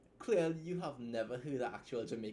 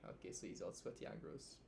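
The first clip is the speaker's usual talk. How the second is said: whispered aside; 2 semitones lower, 10 dB quieter than usual. Real, not acted. Aside while reading, unvoiced.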